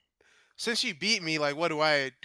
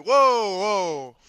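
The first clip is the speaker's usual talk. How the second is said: placating whoa; 3 semitones higher, 9 dB louder than usual. Acted. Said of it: "put my hands up like".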